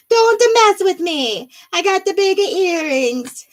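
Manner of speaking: high, hammed-up Sicilian accent; playful threat in a Sicilian accent; mock Sicilian accent. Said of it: "That's my inner Sicilian coming out".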